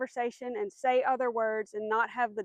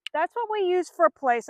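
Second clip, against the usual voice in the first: ordinary delivery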